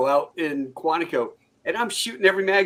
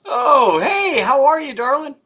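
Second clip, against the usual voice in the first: high, loud sing-song hello; delighted greeting; 10 semitones higher, 8 dB louder, slower than usual. Real, not acted. Greeting the daughter on the call, high and delighted. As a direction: greet her like a happy uncle.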